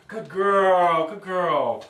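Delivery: loud, drawn-out puppy praise; excited praise. Shown as a demonstration. Trainer praises the puppy for coming, excited drawn-out voice. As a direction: gush over the puppy with stretched-out words. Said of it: "excited voice".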